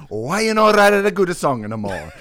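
hammed-up Italian fan scolding the band; Italian accent. Mocking the band in a comic Italian accent. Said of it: "I need to read this in an Italian accent".

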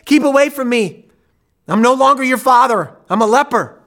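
high, loud desperate outcry; anguished shout. Retold or imitated. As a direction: drive them away in anguish. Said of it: "only to hear him growl".

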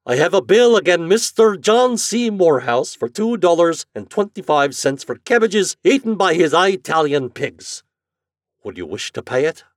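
high, loud official announcement, easing at the final question; stubborn bill collector. A narrator's reading. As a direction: present the bill with stiff formality. Flannery formally presents his bill.

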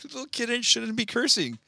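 high-pitched, half-laughing disbelief; mock scandalized. Reacting to a nephew cursing, high mock-scandalized scolding. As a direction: scold a little kid in a high, appalled voice.

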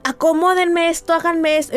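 bossy orders. Retold or imitated. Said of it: "papel de bully".

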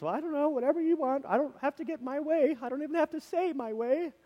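high, fast, put-on doormat voice; mock timid high voice; meek mock voice. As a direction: squeak it out like a pushover with no wants. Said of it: "Don't know why I'm talking like this".

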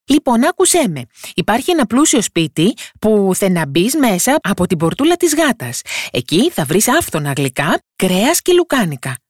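high little voice luring the wolf with sweets; crafty tiny boy. A narrator's reading. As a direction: sell the food to the wolf, sly and eager.

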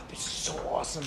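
breathless thrilled whisper; excited whisper. Retold or imitated. Soldier whispering to himself on getting early leave, excited. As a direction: hush it out like you can't believe your luck. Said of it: "I'm like".

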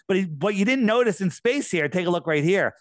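ordinary delivery

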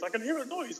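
high, small pupil voice; imitated schoolboy. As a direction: softly squeak it like a little boy. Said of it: "He goes".

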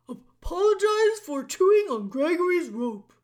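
loud, stammering gruff jailer; bellow, tripping over the words; booming jailer voice. A narrator's reading.

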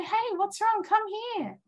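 put-on high, gentle call to a scared child; gentle invitation. Speaker calls gently to her inner child, tender and high. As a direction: coax the frightened kid to come close. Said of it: "But if you say".

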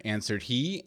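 ordinary delivery, nothing marked

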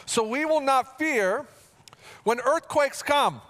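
scripture reading. Acted. Pastor reading Psalm forty-six to the congregation, proclaiming. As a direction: proclaim the verses with weight.

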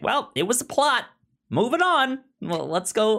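high, mock breezy dismissal; dismissive brush-off. Co-host mocking how the book skips ahead, breezy and high. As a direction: toss it off high and airy.

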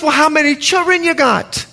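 very high Bahamian granny voice; Bahamian accent. Retold or imitated. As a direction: imitate a puzzled Bahamian grandmother, pitched high. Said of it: "She's Bahamian, excuse the accent".

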